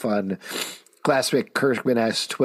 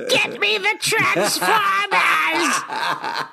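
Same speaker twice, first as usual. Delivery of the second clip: slow theatrical villain menace; high, slow, shouted villain demand; supervillain voice; much slower than usual. Acted. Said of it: "You sound like fucking supervillain when you do that".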